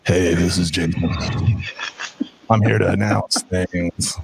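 slow, very low promo voice; Sling Blade-like announcer. Acted. Host mocking his own promo reads, low Sling Blade drawl. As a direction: drag it out low like an overdone announcer. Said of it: "Sling Blade? Like, mm-hmm".

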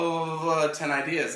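playfully hammed-up eager pitch; mock idea pitching. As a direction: imitate someone bursting with ideas. Imitates partner pitching ideas.

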